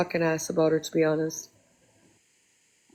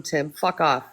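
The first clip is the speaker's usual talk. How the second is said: ordinary talk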